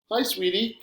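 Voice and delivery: high, sweet motherly greeting; woman's voice. A narrator's reading. Mom greets her son over a video call, high-pitched woman's voice. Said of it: "comes a woman's voice over the computer".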